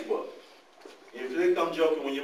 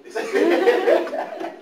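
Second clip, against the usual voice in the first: excited anticipation; 5 semitones higher, slower than usual. Retold or imitated. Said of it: "I said".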